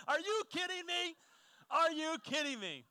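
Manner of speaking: can't believe it, throw it up high; preacher marvelling at brother's memory; disbelief. Real, not acted.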